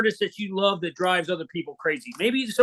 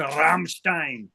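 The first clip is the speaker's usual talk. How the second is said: mock German accent; 5 semitones lower, much slower than usual. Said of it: "Is that your English accent?".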